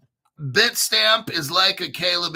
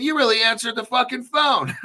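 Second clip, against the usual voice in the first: laughing disbelief; 7 semitones higher than usual. Retold or imitated. Tells the support agent he answered, laughing in disbelief. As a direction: crack up in amazement as you say it. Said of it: "I said".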